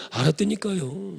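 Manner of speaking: slow, drawn-out Chungcheong brush-off; drawled Chungcheong yes. Retold or imitated. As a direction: stretch the Chungcheong reply lazily. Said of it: "충청도 사람 전도하면".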